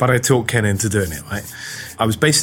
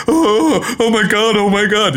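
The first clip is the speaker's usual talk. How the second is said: high, louder mock panic; panicked companion; 10 semitones higher, 3 dB louder, slower than usual. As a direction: freak out like a terrified hairdresser. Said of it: "he starts going".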